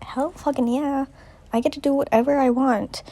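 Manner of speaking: put-on high-pitched gleeful kid; excited Ralph. Boy finds no adults on the island, excited. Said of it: "Ralph is pretty excited".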